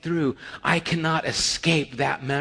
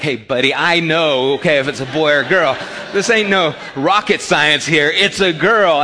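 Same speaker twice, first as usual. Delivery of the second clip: louder, cocky dismissal; mock exasperated retort; 6 dB louder than usual. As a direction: brush off the doctor, sure of himself. Retorting to doctor in delivery.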